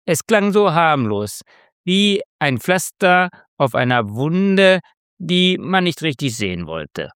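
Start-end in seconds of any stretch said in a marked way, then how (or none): none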